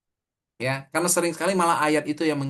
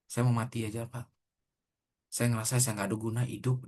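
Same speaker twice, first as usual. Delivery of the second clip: hushed, softer, defeated voice; despairing student; 3 semitones lower, 6 dB quieter than usual. Retold or imitated. Preacher retells the hopeless student in his office, quiet and broken. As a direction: let it sink as if near tears. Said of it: "Dan dia betul-betul nangis".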